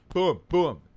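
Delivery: two loud, high punched booms; chest-thump booms. Shown as a demonstration. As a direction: punch out each boom like a thump. Demonstrating banging on the chest.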